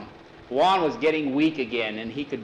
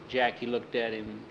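usual speaking voice

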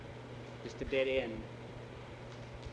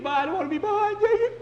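very high, loud plea; wailing scared boy; frightened whine; 9 dB louder, faster than usual. Acted. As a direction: beg a friend in a panic. Scared boy pleads with friend.

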